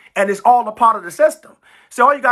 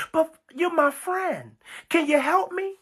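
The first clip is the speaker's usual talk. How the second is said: high, softer mock plea; acted begging, pitched up and quieter; pleading; 6 semitones higher, 6 dB quieter than usual. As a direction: wheedle a friend for help. Acting out sinner begging God.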